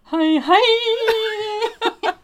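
squeaky falsetto hi, hi; laughing falsetto singing parody; mock high note. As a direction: imitate a tenor straining up high. Mocking singer's high note.

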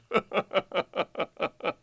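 long chuckle falling away; hearty laugh. A narrator's reading. Coachman laughing at the girl's question, hearty laugh. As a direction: laugh in amused bursts. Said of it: "laughed and said".

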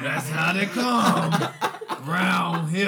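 put-on slow old-man voice through laughter; imitating grandpa voice. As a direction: copy the grandpa voice while laughing. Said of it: "With this grandpa ass voice".

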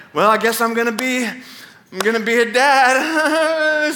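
hammed-up tearful wail; laughing-crying. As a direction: imitate a freaked-out man breaking into a sob. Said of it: "sort of laughing, sorta crying thing".

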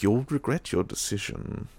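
quiet threat. Retold or imitated. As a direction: murmur the threat darkly. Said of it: "he said".

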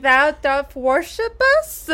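mock archaic witch. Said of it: "she's like".

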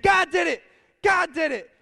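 high, loud shouts of praise; joyful shout. Retold or imitated. Lady still shouting praise at her porch. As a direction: belt it out in triumph. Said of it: "she's over here saying".

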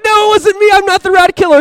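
put-on a cornered teen yelping he's innocent; high, loud protest. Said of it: "I'm like".

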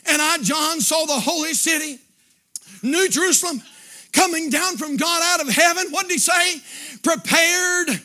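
full-force scripture preaching, punched phrase by phrase; impassioned preaching. Acted. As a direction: declaim the verses in a driving revival cadence.

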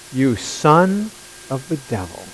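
slow, deliberate, dropping away softly; quietly pointed quote; hushed rebuke. Acted. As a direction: let the accusation sink to a low hush. Acts Saul's rebuke, hushed.

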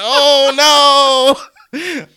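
high, slow, hammed-up wail; mock despair. New kid mock-wailing after a failed first meeting, high and slow. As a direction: wail like it is the end of the world. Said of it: "So I was like".

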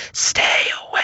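hushed, eerie little voice; creepy child whisper. Retold or imitated. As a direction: breathe it like a ghost child. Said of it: "creepy little voice like".

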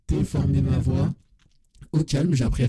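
processed, warped voice effect; slowed, altered studio voice; distorted voice. Shown as a demonstration. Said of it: "regarde un petit peu ce que j'ai appris à faire".

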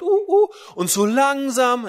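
put-on high, slow baby talk. Shown as a demonstration. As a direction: coo it slowly like to a baby. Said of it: "warum redet man mit Kindern so komisch?".

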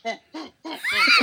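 high hoots rising to a loud yelp; hooting back. Retold or imitated. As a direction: hoot right back at the kid. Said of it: "and he goes".

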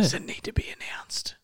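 a playful conspiratorial whisper. Pretend. Hosts whispering about a secret, playful whisper. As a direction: breathe it with no voice at all. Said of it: "Why are we whispering?".

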